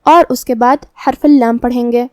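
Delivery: usual speaking voice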